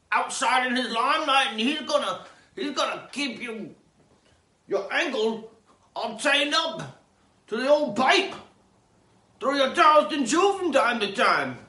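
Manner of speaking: high, loud ranting; mock rant. Host ranting about Zane's basement captive, loud.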